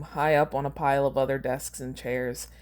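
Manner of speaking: plain reading voice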